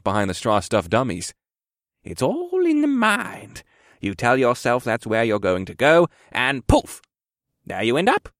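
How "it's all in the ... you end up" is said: put-on old man character voice with swooping pitch; old quartermaster teaching a pupil; explaining old quartermaster. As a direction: demonstrate like an old mentor.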